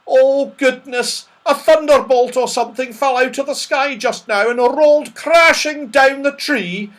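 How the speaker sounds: high, loud, breathless-with-alarm report; scared. Acted. Silky telling of the thunderbolt crash, alarmed. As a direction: exclaim in a high frightened voice. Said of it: "looking scared out of her life".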